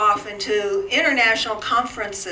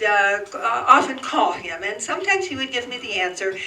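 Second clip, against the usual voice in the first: ordinary delivery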